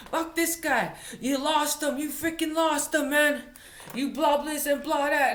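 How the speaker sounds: high-pitched mock rant; mad engine boss. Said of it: "boss is getting mad at me".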